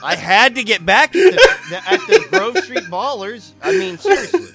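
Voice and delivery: loud, high, indignant defence through laughter; laughing protest. Real, not acted. Player justifying his plan to game master, amused loud protest. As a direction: protest loudly over the laughing table.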